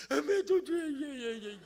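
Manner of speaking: a hint of a whimpering child; lightly voiced whiny kid; whining child. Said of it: "자식이 밑에서". (살짝 칭얼대는 아이 흉내)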